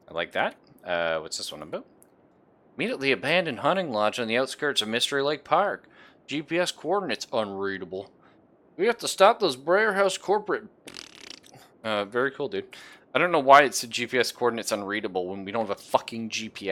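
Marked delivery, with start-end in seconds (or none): 2.8-10.6 fiery revolutionary speech. Acted. Reading second rebel note.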